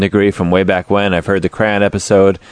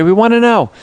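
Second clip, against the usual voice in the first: higher, louder, insistent ask; eager plea; 12 semitones higher, 3 dB louder than usual. Acted. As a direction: push the invite, eagerly. Podcast host inviting email.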